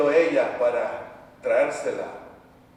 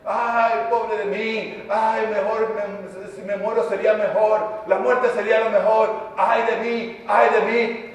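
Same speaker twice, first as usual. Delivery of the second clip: higher-pitched wail of self-pity; woe-is-me moaning, acted by a preacher; self-pitying victim; 6 semitones higher, 5 dB louder than usual. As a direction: lament your lot like a victim. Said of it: "No te hagas la víctima".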